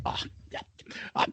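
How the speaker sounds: hushed, barely voiced stammer; flustered teacher. Teacher finding water on the blackboard, hushed fluster. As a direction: mumble it weakly, very softly. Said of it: "he'd come over and be like".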